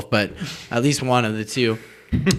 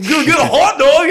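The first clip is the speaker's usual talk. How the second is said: high, loud, laughing goofy voice; mock dumb kid; 13 semitones higher, 8 dB louder than usual. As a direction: play the clueless kid through laughter. Mocking his own oblivious self.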